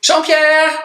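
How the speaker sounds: high-pitched imitation of a little girl calling out; cri de fillette imité. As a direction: call the name high and drawn-out, like a four-year-old. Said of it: "je l'entends crier". (cri aigu de petite fille, imité)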